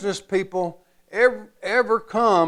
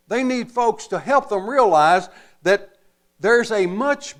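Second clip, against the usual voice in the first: higher, building sermon push on salty people; rising preaching; 3 semitones higher than usual. Acted. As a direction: preach it, lift the pitch and lean in.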